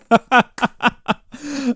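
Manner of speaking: high, loud burst of real laughter; delighted laugh. Real, not acted. Streamer tickled by a viewer's username, delighted laugh. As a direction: crack up out loud.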